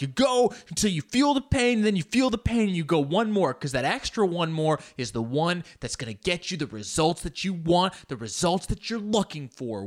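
loud, high-pitched mock gym motivator; hammed-up workout pep talk, pushing hard; hyped-up fitness influencer. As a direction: imitate a pumped-up internet muscle guy egging people on. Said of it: "fucking internet jacked people are like".

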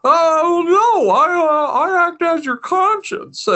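high, earnest halting drawl; Jimmy Stewart voice. Retold or imitated. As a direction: gently explain like Jimmy Stewart. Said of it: "Jimmy Stewart voice".

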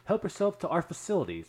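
lilting, hospitable put-on voice; mock gracious hosts. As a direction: imitate relatives graciously offering their home. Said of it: "because that's how they talk".

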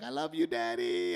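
put-on high, loud little-girl voice; imitating little daughter. Telling the show's host about his daughter, high child voice. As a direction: chirp it like his young daughter. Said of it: "Delilah was like".

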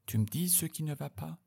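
a light murmur, coaxing him to confide. Retold or imitated. Julie leans in and murmurs to him, softly. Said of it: "susurre-t-elle".

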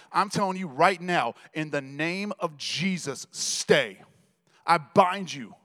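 loud, firm spiritual command building to a shout; commanding rebuke. Retold or imitated. Father rebuking a spirit in the painting, commanding. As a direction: bind the spirit with rising authority. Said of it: "And I got mad".

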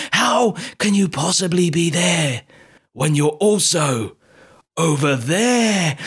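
very slow, stretched-out stunned question; horrified disbelief. Acted. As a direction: draw each phrase out as if facing a ghost. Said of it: "this look of horror on her face".

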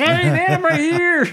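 loud, high comic yell; hammed-up high protest at the noise; pained protest. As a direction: shout back as if being deafened. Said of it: "Ezekiel's, like, covering his ears".

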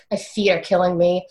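usual speaking voice

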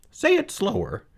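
high, puzzled piglet voice; high-pitched piglet asking; Wilbur the pig. Acted. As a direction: ask like a confused little pig. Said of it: "said Wilbur".

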